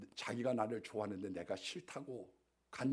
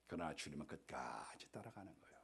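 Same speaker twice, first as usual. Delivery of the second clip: 숨소리로 조용히 속삭이듯; 비밀을 털어놓듯 낮게 속삭여; 속삭임; 11 semitones lower, 12 dB quieter than usual. Real, not acted. Hushed contrast in sermon. (주님이 끝까지 따라오신다고 속삭이듯)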